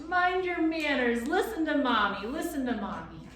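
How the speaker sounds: high, loud mocking imitation; mocking parrot. A narrator's reading. Gaboons mocking the bird, imitating. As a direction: parrot her scolding with glee.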